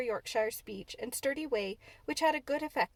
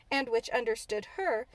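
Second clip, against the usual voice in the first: usual speaking voice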